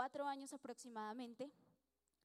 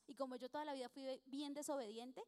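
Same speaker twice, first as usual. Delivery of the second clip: ordinary talk, nothing marked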